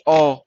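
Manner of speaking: ओ drawn out; long vowel. Shown as a demonstration. Teacher demonstrating the vowel's length, long ओ. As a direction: hold ओ as one long vowel. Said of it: "काफी लंबी जा रही है".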